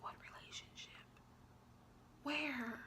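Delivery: hushed, breathless what relationship; disbelieving whisper. Retold or imitated. Answering the boyfriend's claim in a stunned whisper. Retelling her reply to boyfriend.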